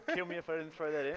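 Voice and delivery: fast, said through a laugh. Real, not acted. Joking about the Australian mention.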